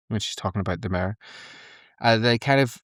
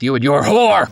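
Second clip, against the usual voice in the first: mock villain venom; 4 semitones higher, 8 dB louder than usual. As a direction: punch the last word as a hammed-up villain. Voicing the mayor's venomous insult.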